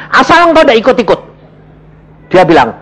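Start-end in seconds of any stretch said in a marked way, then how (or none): 0.1-1.2 cheeky. Retold or imitated. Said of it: "Aku bilang".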